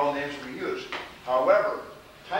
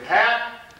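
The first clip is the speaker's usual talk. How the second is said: calling out; 10 semitones higher, 10 dB louder than usual. Real, not acted. Teacher calls on a student.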